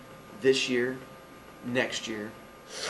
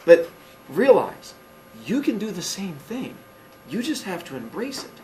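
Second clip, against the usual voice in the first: urgent appeal; 5 semitones higher, 5 dB louder than usual. Real, not acted. Urging the audience.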